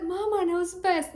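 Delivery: mock little girl. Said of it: "No, she didn't say that, I'm joking".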